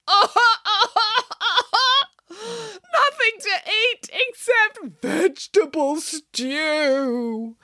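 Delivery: high, very slow blubbering; sobbing wail. A narrator's reading. Boris faced with vegetable stew, sobbing wail. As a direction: bawl it out, dragging every word. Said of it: "he broke down to loud sobs".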